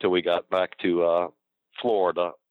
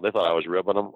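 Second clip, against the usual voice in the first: usual speaking voice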